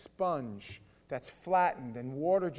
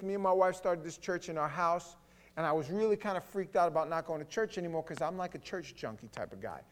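ordinary talk, nothing marked

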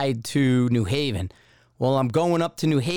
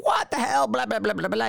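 mock yelling; 8 semitones higher than usual. Staff sergeant tearing into a new recruit, mock yelling. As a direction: imitate a yelled tirade collapsing into blah-blah. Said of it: "He's yelling at me".

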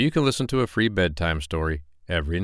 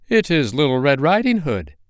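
put-on high girl voice; 6 semitones higher, 4 dB louder than usual. Wolf imitating the girl.

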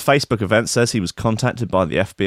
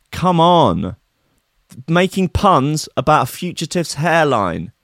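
ordinary talk, nothing marked

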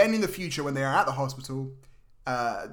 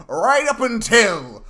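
mock exclaim; 4 semitones higher, 8 dB louder, much slower than usual. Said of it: "the next door neighbor".

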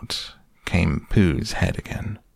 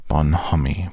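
ordinary delivery

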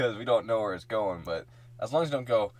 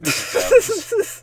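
high silly voice; 12 dB louder, slower than usual. Pretend. High put-on voice.

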